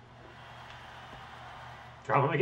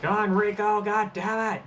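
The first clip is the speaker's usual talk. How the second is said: loud, higher, heated shout at the screen; frustrated yell; 6 semitones higher, 3 dB louder than usual. Real, not acted. Player yelling at Rico during the match, loud and frustrated. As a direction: urge him on, then curse.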